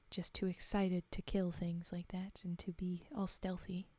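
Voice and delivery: hushed personal remark between readings; whispered aside. Real, not acted. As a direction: whisper the comment to yourself.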